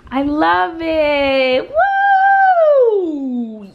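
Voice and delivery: slow, then a loud high whoop; delighted whoop. Real, not acted. Thrilled with her new girly bed, a loud whooping cheer. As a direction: let the joy burst out.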